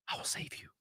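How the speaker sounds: whispered promise to save her; mock whisper. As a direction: breathe it like a secret vow.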